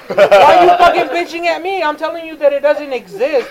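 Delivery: high, indignant protest; exasperated outburst. Retold or imitated. Game clerk telling customer the DS doesn't exist, high and exasperated. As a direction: burst out at the stubborn customer.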